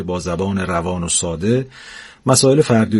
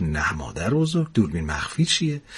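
softer, lower soothing reply; calm the excited grandma kindly; gentle angel; 4 semitones lower, 8 dB quieter than usual. Acted. (جواب آرام و مهربان فرشته)

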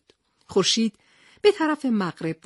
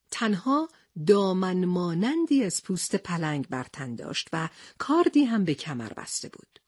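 plain reading voice

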